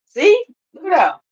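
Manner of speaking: high, loud see, look at that; excited look. Real, not acted. Pointing out a viewer's comment, a high loud excited call. Sudden exclamation.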